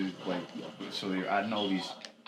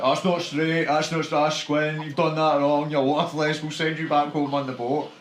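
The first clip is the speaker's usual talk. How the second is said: louder, higher mock-berating of the worker; mocking abuse; 5 semitones higher, 10 dB louder, slower than usual. Coworkers jeering at the TV fitter, loud mock abuse. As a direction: bark out the coworkers' nitpicking insults, hammed up. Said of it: "they were just constantly like".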